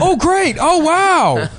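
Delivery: very high, hammed-up delight; loud happy exclaiming. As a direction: gush like an over-excited fan, mocking him. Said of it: "had to loudly exclaim".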